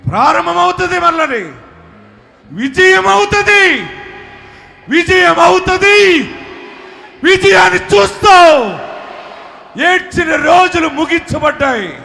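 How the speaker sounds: preacher proclaiming abundance to the crowd, shouting; preaching at full force. Acted. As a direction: shout the victory to the hall. Climactic proclamation of victory.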